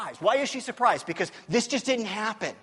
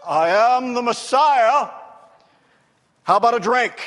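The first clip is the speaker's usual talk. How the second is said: grand announcement; 10 dB louder than usual. Retold or imitated. Jesus arriving with angels and smoke machines, booming grandly. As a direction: announce yourself with huge showy grandeur. Mock grand entrance of the Messiah.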